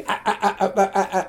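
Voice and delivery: mock stutter on repeated ah sounds; a light stammering impression of the deputy; imitating a stammer. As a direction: stumble over the ah like he stammered. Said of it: "he was a guy stammered".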